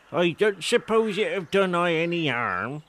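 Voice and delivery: put-on a proud old villager boasting of his garden; old Wiltshire countryman. A narrator's reading.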